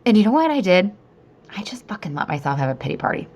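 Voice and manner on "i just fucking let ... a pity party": a fast, voiceless whispered confession. Real, not acted. Telling viewers how she gave up on the mountain, in a whisper. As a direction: drop to a secret whisper, half-ashamed.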